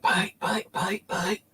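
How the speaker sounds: high, frightened calling out a name; scared cry in the dark; panicked shout. Retold or imitated. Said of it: "I'm fucking shouting out".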